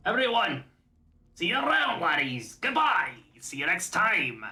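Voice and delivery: put-on Scottish-accented goodbye shouted to the viewers; hammed Scottish farewell. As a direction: wave everyone off like a dwarf.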